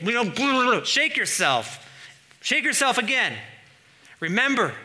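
loud, high stern warning; Jesus warning Sardis, loud and forceful; threatening. Acted. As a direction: call the sleeping church awake with a stern threat. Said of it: "He's threatening Sardis".

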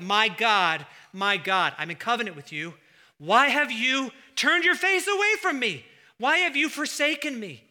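Jesus crying out in anguish to the Father. Acted. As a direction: cry out as if forsaken on the cross. Voicing Jesus's cry on cross.

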